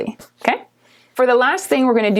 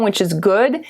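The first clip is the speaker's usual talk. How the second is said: usual speaking voice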